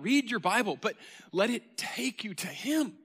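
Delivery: usual speaking voice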